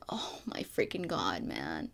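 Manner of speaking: hushed, overwhelmed whisper; awed whisper. Real, not acted. Fan overwhelmed by Garrus's declaration of love, awed whisper. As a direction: drop to a whisper in disbelief.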